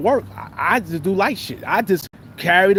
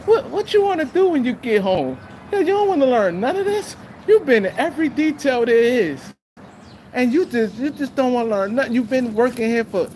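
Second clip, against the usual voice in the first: imitating the Italian boss, much higher; a nagging, high lecturing boss; mock annoying voice; 6 semitones higher than usual. Italian carpentry boss scolding the lazy inmate, higher imitation. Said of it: "annoying little voice".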